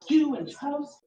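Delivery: usual speaking voice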